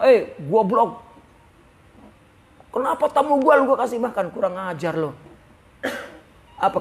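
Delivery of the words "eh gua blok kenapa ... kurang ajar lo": mock anger. Abu Bakar scolding his son over the uneaten food, mild mock anger. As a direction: scold the son, half-acted. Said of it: "Sampai marah dengan anaknya".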